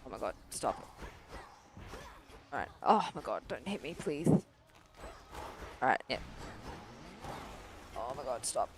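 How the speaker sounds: hushed groaning plea; muttered dread. Real, not acted. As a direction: grumble under your breath as it hits. Low muttering under attack.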